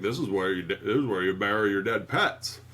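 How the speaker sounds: low-pitched neighbor voice; a hint of the ominous local guide; Judd. Host plays the neighbor showing the graveyard, lightly voiced low. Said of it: "he's like".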